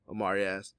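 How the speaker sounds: ordinary delivery, nothing marked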